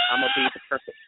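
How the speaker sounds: high, fast mock prim guest; perfect guest voice. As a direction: put on a prissy polite voice. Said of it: "I'm gonna be the perfect guest".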